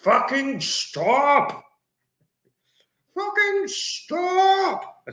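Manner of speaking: very high, drawn-out mock bellow; hammed-up celebrity impression, slow and high; Brian Blessed. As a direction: stretch each word in a strained high cry. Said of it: "That's how he would say it".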